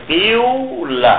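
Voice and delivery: قیولا said slowly from a kasra with a slight u rounding; ishmam demo. Shown as a demonstration. Teacher repeating the ishmam example for students, slow. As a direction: say قیولا slowly, kasra first but lips rounding toward u. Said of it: "تلفظ کہاں سے ... کرتے ہوئے؟ کسرے سے".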